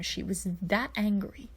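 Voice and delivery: ordinary delivery, nothing marked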